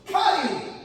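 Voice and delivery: loud, high coach's yell; shouted order. Retold or imitated. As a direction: bark the order across the mat. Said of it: "I go".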